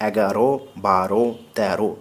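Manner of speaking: এগারো, বারো, তেরো said slowly with the final ও clearly voiced; slow correct pronunciation. Shown as a demonstration. Teacher slowly repeats the correct numbers for the class. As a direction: draw out এগারো বারো তেরো, each ending on a full o. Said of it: "এরকম নয়".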